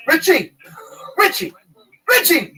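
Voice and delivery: high, loud calling out a name; repeated loud call. Retold or imitated. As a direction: call across the shop. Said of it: "He'd go".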